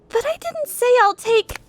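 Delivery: high, rushed objection; flustered protest. Acted. Heroine trying to refuse the bath, high flustered blurt. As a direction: blurt it out before he cuts in.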